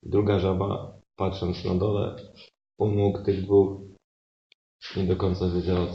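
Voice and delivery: usual speaking voice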